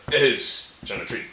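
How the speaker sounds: "is" stretched out and punched at the front; stress on IS. Shown as a demonstration. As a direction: hit IS slowly and hard, then let the rest fall. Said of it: "add emphasis on a certain thing".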